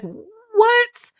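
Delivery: high, incredulous squeak of disbelief; shocked what. Retold or imitated. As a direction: let the word shoot up in stunned disbelief. Group's disbelief at accusation.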